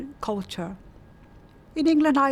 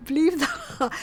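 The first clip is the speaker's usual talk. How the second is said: laughing, higher-pitched incredulous question; amused disbelief; 6 semitones higher than usual. Real, not acted. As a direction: break into a laugh as you ask it. Amused at the old house price.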